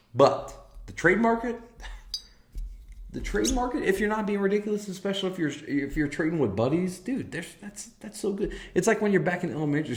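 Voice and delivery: rising enthusiasm, voice climbing; high, eager praise of trading with buddies; excited. Real, not acted.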